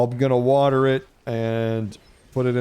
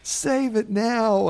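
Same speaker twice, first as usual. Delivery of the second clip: much higher imitated little kid crying out in panic; screaming kid; 12 semitones higher, much slower than usual. Little son begging daddy to save the wasp, high panicked child's voice. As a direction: play the small son pleading, pitched way up. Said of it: "He's like".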